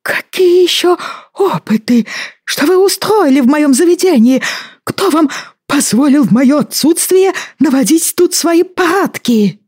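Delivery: put-on high, outraged old man, slowing; furious pompous apothecary; indignant old man voice. Indignant ex-apothecary berates Stein.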